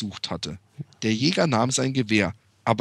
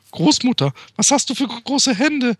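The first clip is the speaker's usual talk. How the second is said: put-on higher, louder girl asking; Little Red voice; 10 semitones higher, 4 dB louder than usual. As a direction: ask like a wide-eyed little girl. Little Red asks, rising.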